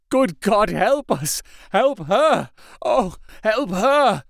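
high anguished cry for help; desperate plea. A narrator's reading. Husband begging the doctors to save his wife, desperate cry. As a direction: plead desperately, drawing out the last cry.

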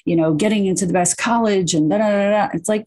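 usual speaking voice

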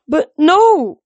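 high, loud anguished protest; whimpering. A narrator's reading. Satyr begging his god not to go, whimpering. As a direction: whimper it upward on the last word. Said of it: "Grover whimpered".

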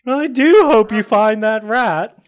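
a parting jab, airy and smug; lightly voiced gentleman. Character parting line to guard.

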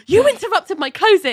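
high, indignant outburst; high-pitched retold yell of protest; mock scream. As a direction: shriek it as John, mock-outraged. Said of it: "they scream at each other".

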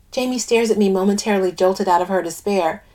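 ordinary delivery

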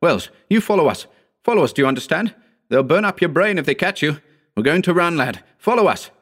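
high, insistent commands; urgent cry. A narrator's reading. Howard ordering injured Wells to follow, urgent high cries. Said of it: "cried Howard".

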